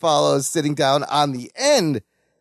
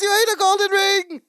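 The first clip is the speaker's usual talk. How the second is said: retold scream; 13 semitones higher than usual. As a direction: imitate a horrified scream at ordinary volume. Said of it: "sees this and screams again".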